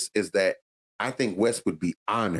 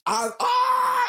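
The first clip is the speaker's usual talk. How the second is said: excited shout. Real, not acted. Fan reacting to the wrestler's line, excited shout. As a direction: yelp with glee.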